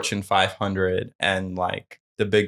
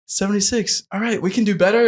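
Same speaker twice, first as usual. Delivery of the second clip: much higher, imitated Australian presenter; rally the crowd in a raised impression voice; Adam, rallying the room; 12 semitones higher than usual. Presenter urging the room to sign up, imitated rallying voice. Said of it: "Adam's like".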